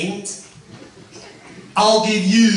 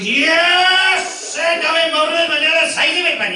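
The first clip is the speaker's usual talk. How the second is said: excited farmer; 7 semitones higher than usual. Acted. Excited farmer greets the helicopter.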